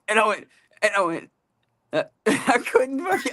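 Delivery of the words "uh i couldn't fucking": real laughter breaking the words, high and slow; laughing now. Real, not acted. Retelling the embarrassing moment, really laughing while talking. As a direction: laugh through the line, unable to finish. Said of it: "I'm laughing now".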